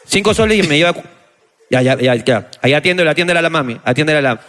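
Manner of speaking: deep, steady market sales patter; recorded vendor loop. Retold or imitated. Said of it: "decía".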